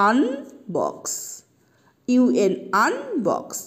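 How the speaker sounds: আনবক্স slowly, the un as a short uh; slow model word. Shown as a demonstration. Teacher modeling আনবক্স slowly for students. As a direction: stretch আনবক্স, uhn not yoo. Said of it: "এর উচ্চারণটা হয় কি".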